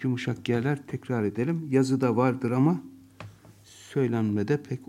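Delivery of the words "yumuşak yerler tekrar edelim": ordinary talk, nothing marked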